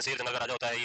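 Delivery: low monotone memorizing drone; rote recitation. Shown as a demonstration. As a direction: recite it like a student cramming by heart. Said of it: "फिर उसको रटते हैं".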